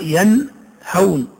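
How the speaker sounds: ينهون slowed, nun sounded distinctly before ه; very slow ينهون, ن pronounced plainly; clear nun. Shown as a demonstration. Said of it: "تقرأ".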